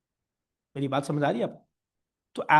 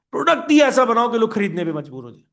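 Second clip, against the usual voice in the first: higher, louder rallying command; forceful company order; 6 semitones higher, 6 dB louder than usual. Acted. Company tells staff to focus on product, forceful order. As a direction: drive the order home emphatically.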